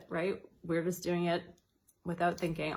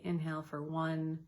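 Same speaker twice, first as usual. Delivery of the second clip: softer and softer counting, pause held; counted breathing; 3 dB quieter, much slower than usual. Shown as a demonstration. Guiding listeners through timed breathing, counting softly down. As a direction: guide the breath count gently, fading. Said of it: "it would sound or look like this".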